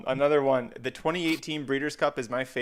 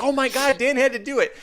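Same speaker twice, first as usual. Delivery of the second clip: excited; 10 semitones higher than usual. Retold or imitated. Group texting Dan after win.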